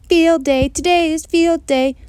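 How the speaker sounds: high, loud happy shouting; excited shout. A narrator's reading. Girl shouting happy news to her dog, loud. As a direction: yell the news out with glee. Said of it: "I shouted to my dog".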